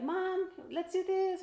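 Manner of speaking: a hint of a child begging mom; kids pleading. Tired mom imitating her kids asking to play. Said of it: "the kids are like".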